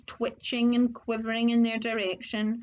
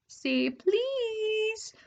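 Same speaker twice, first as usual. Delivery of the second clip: high, drawn-out taunt; teasing poltergeist; 11 semitones higher than usual. Acted. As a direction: dangle it in front of him. Peeves teasing Filch.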